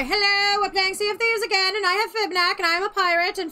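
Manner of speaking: high, lively host greeting; bouncy pirate-video host voice; excited intro. Acted. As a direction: sell the intro to viewers.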